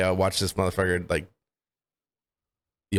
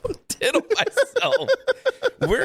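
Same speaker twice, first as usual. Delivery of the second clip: high-pitched, laughing disbelief; laughing protest. Real, not acted. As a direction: object while cracking up.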